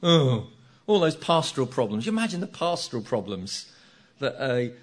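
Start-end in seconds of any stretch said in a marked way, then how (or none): 0.0-0.5 mock-horrified oh; dismayed groan. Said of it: "they go".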